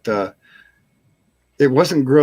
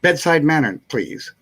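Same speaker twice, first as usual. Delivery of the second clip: usual speaking voice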